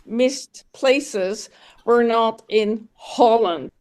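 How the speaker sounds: ordinary talk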